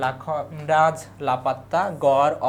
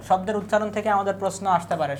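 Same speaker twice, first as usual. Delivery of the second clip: normal talking voice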